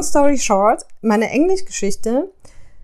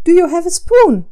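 louder and higher, impatient; impatient repeat; 8 semitones higher, 7 dB louder than usual. Retold or imitated. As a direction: repeat it like she's losing patience. Kundin wiederholt ungeduldig. (ungeduldig lauter und höher wiederholt)

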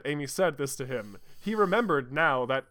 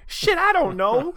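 high, loud, flustered outburst; worked up; 13 semitones higher, 7 dB louder than usual. Acted. Amy flustered over Sonic's question, worked up. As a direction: blurt it out all worked up. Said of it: "still all worked up".

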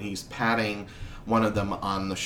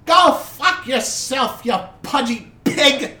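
much higher, slow, loud jeering voice; angry old woman; 13 semitones higher, 7 dB louder, slower than usual. Acted. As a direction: curse the duke before throwing the stone. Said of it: "she says".

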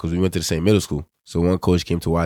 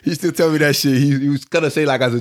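laughing; 9 semitones higher than usual. Real, not acted. Laughing while recalling the story.

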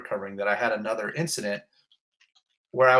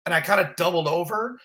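normal talking voice

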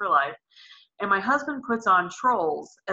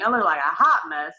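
ordinary delivery

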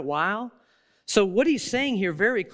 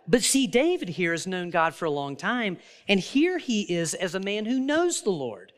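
normal talking voice

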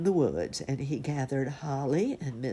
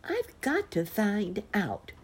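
slow, higher-pitched determined cub; determined bear cub; 7 semitones higher, slower than usual. A narrator's reading. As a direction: insist like a little bear cub. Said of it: "he looked very determined".

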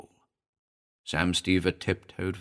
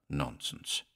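hushed murmur; murmured under breath; 5 semitones lower, 12 dB quieter than usual. Acted. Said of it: "murmur under his breath".

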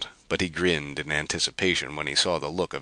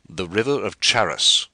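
louder, excited announcement; exclaiming; 5 semitones higher, 6 dB louder than usual. Acted. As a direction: point it out with excitement. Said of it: "exclaimed Detis".

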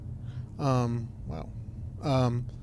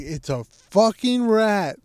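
higher, slow, hammered-out insistence; sister's outraged voice; 5 semitones higher, 4 dB louder, slower than usual. Retold or imitated. Sister insisting it was a rat, mock furious. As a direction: stretch and punch each word in mock fury. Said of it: "put in her voice".

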